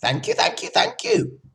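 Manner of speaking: very high honking thank yous; flustered goose. A narrator's reading. Goose thanking Charlotte while nodding and bowing, honking. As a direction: gabble it like a proud goose. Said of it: "said the goose, nodding and bowing".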